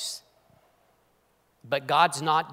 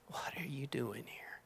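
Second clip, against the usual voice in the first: still small voice; 3 semitones lower, 19 dB quieter than usual. Acted. A preacher voicing God's whisper to Elijah in the cave. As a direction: whisper it like a voice barely heard. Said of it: "Still small voice".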